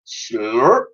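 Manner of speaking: drawn-out slurp rising at the end; swooping upward sound effect; imitated slurp. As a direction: say slurp like sucking up jam. Mammoth slurps up jam.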